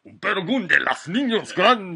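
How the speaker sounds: booming, slow Spanish radio announcer; Mexican announcer voice. Pretend. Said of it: "Mexican announcer voice".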